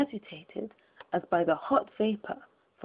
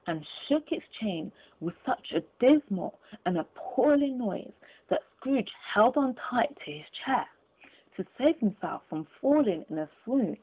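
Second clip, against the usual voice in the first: usual speaking voice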